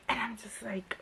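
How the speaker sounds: breathy disbelieving whisper under the breath. Real, not acted. Reviewer reacting to the heroine's excuse, whispering. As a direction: drop to a stunned whisper.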